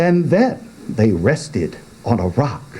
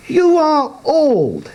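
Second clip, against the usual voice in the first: put-on young man voice. Youth voice.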